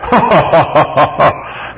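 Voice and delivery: high, loud signature laugh; demonstrating the radio laugh; his famous laugh. Shown as a demonstration. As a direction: let out the laugh everyone knows. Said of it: "I'd know that laugh anywhere".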